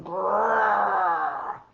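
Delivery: loud growl in a voice unlike her own; badger growl. Storyteller voicing a badger for a child, loud growl. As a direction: growl like a scary animal. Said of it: "and he's going".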